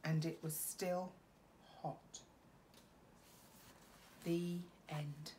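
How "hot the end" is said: very soft final word; hushed ending. A narrator's reading. Teacher ending the story gently. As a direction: let it land softly.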